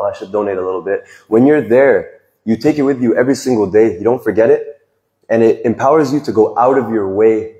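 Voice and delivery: ordinary talk, nothing marked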